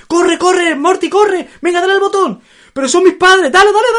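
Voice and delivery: panicked shouting while running; being chased. Retold or imitated. Retelling frantic cartoon chase scene.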